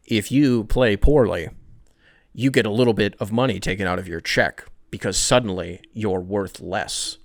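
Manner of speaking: put-on a mild Serbian accent, deadpan; Nikola Jokic impression. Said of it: "what he sounded like in the locker room".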